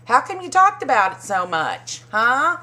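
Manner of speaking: mock Andy. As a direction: poke at her like a gossip host. Voicing Andy's needling question.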